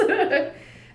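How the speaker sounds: a short real laugh at her own stumble; embarrassed laugh. Real, not acted. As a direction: laugh it off after tripping on a word. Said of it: "oh, goodness".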